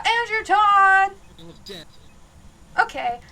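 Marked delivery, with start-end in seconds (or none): 0.1-1.1 slow, drawn-out yell, louder and higher; yelling a name. Real, not acted. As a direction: call the ghost by name at full volume. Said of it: "by yelling the guy's name out".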